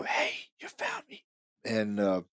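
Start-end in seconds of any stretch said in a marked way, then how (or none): 0.0-1.2 a high ghostly whisper; imitating the female ghost in the clip; whispery female voice. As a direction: whisper it like a spirit on tape. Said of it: "You're gonna hear a female voice go".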